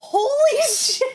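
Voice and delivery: high, loud, slow holy shit; laughing exclamation of shock; stunned laugh. Real, not acted. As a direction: burst out in disbelief. Reacts to the long charge list.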